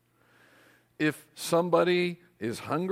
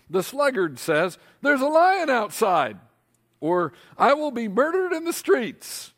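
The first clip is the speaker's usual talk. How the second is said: mock fearful excuse; 8 semitones higher, 5 dB louder than usual. Preacher mocking the sluggard's excuses, hammed-up alarm. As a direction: ham up a lazy man's frightened excuse.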